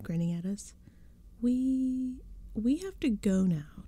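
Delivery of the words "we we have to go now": drawn-out, frightened stammer. A narrator's reading. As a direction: stall on the first word, then plead slowly. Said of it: "Tabby stammered".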